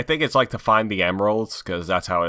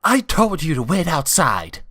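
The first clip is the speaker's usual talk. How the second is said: scolding Rouge; 6 semitones higher than usual. Acted.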